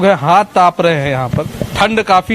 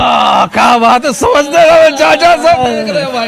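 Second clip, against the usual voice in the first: higher, louder, slower delighted exclamation; excited cheer; 7 semitones higher, 4 dB louder than usual. Real, not acted. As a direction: cheer the uncle on, grinning. Reporter reacts to uncle's sharp point.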